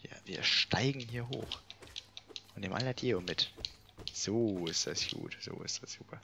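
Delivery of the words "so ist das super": breathy whisper, no voice at all; soft pleased whisper under the breath. Real, not acted. Pleased with a game move.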